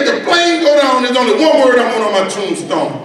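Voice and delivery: high, hammed-up insistence easing down; exasperated insistence. Husband finally telling his wife his wish, high mock insistence. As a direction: start high and press the point, playing it for laughs.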